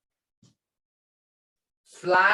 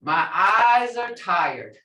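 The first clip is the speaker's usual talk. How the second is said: slow and weary, drawn out; slow, tired; 5 dB louder, slower than usual. Shown as a demonstration. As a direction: drag out My eyes are tired wearily. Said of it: "Ja dothwa".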